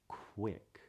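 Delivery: "quick" as k, drawn-out oo, then ick; rounded W glide. Shown as a demonstration. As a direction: stretch the hidden W in "quick". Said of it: "sneaks its way into Q U words".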